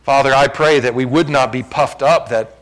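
slow, solemn pulpit prayer; public prayer. Real, not acted. Pastor prays to Father that church renew love, solemn. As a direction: pray aloud in measured phrases, stretching key words.

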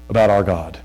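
ordinary delivery

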